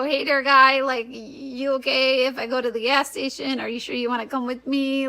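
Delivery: hammed-up Wisconsin accent; put-on Midwestern Wisconsin accent. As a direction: imitate folksy Wisconsin talk. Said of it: "We talk like".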